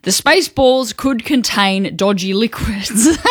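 slow, fighting back a laugh; trying to keep a straight face; holding back laughter. Real, not acted. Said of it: "Just straight face".

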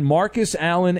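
usual speaking voice

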